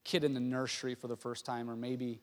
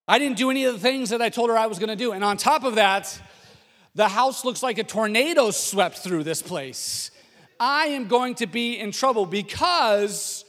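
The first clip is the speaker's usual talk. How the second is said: loud, high-pitched worried husband; husband in a comic panic, pitched up and loud; hammed-up dread of being in trouble; mock panic; 6 semitones higher, 10 dB louder than usual. Husband panicking before wife returns.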